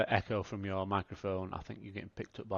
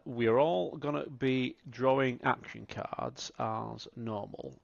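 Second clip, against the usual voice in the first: ordinary delivery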